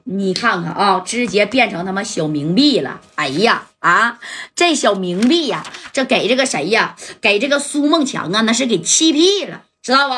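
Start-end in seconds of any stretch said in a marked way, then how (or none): none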